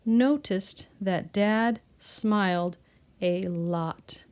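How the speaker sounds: slow, word-by-word reading aloud; deliberate, separated words for pupils to follow; model sentence reading. Shown as a demonstration. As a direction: read it out slowly for beginning readers. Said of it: "let's read our sentence".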